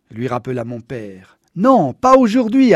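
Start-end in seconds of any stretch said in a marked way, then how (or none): 1.6-2.7 defiant. A narrator's reading. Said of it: "assura Monsieur Nation".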